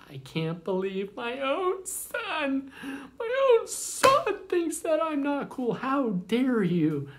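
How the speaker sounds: very high, slow, hammed-up hurt outrage; wounded, indignant mom. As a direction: act deeply offended like a dramatic mother. Hurt mom reacts to son.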